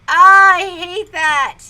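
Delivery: loud, slow groan of frustration; exasperated. Real, not acted. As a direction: groan it out, fed up. Exasperated outburst.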